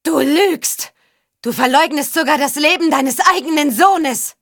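high, frantic accusing cry; voice near breaking. A narrator's reading. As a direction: cry out the accusation at the top of your range. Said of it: "Ihre Stimme drohte sich zu überschlagen". (schriller Aufschrei, fast überschlagende Stimme)

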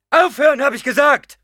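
high, loud repeated order; shouted command. A narrator's reading. (scharf gerufener Befehl)

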